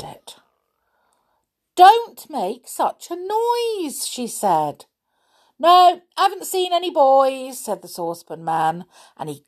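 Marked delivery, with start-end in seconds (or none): 1.7-4.1 high, drawn-out mother's scolding. A narrator's reading. Mother scolds noisy visitor. 5.6-7.6 high, loud reply from someone hard of hearing; mishearing saucepan man. A narrator's reading. Half-deaf saucepan man mishears mother.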